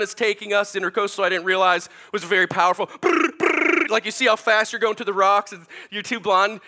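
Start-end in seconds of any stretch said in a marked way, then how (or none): 3.0-3.9 high, loud mock engine sputter; playful motor noise for the crowd; imitated sputtering motor. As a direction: buzz your lips like a dead outboard. Stalled boat motor imitated.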